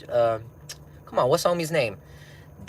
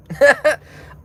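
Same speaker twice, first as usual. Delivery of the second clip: loud high laugh; 14 semitones higher, 10 dB louder than usual. Real, not acted. Laughing after punchline.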